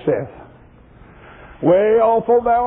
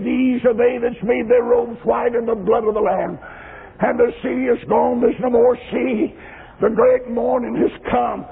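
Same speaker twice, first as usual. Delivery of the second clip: rhythmic pulpit chant building to the announcement; fervent revival recitation; intoned preaching; 5 semitones higher than usual. Acted. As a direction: proclaim it in rolling, level-pitched phrases.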